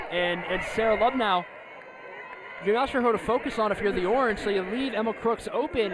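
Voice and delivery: usual speaking voice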